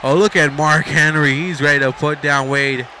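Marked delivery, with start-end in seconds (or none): none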